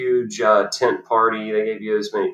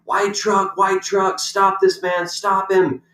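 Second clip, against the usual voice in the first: mock screaming; 9 semitones higher, slower than usual. Parking lady alerting others to a truck, mock screaming. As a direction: raise a frantic alarm in mock screams. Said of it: "and then she starts screaming".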